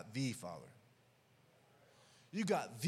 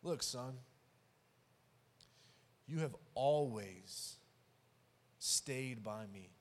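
tender father; 6 semitones lower, slower than usual. Retold or imitated. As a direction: ease him down gently, no push. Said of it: "I love what the father says back".

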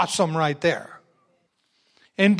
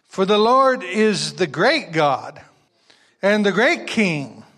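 slow emphatic reading; 5 dB louder, slower than usual. Acted. Reads verse with emphasis.

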